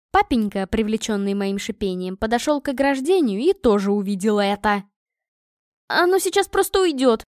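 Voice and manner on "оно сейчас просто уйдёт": high desperate squeal; desperate squeak. Acted. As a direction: squeak it out in despair. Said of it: "пропищала я в отчаянии".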